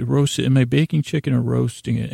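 normal talking voice

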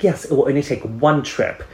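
bright, sure "one trip"; cheerful overconfidence; overconfident cheer. Retold or imitated. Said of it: "I was like".

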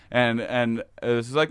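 usual speaking voice

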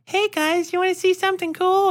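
put-on high, eager little-girl voice; girl's eager voice. Lonely girl imagines inviting kids to play, high and eager. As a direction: invite them like a lonely kid.

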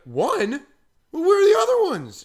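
a father yelling in disbelief; dad panicking about his missing kids; alarmed dad. Retold or imitated. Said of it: "my dad's like".

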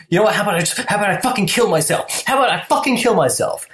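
high, fast mock-hysterical outburst; rushed high-pitched mock threat, not truly shouted; shrill impression. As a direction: imitate an obnoxious, shrill ex. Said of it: "shrill and obnoxious".

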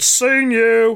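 high, sharp gotcha call; sudden call-out. Retold or imitated. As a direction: catch him out with a pounce. Mystery voice calls out nearby.

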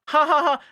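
very high, loud fake laugh; mock laugh. Pink explaining he's only a dog, fake laughing. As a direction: force out a high ha-ha-ha.

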